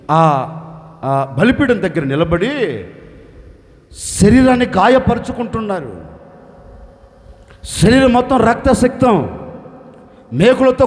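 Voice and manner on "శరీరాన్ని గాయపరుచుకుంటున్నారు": ordinary talk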